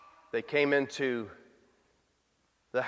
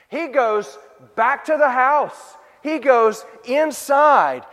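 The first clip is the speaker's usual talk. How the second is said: louder, higher, deliberate pace; slow emphatic preaching; 6 semitones higher, 7 dB louder, slower than usual. Acted. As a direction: plant each word firmly. Preacher stressing Jesus walks on.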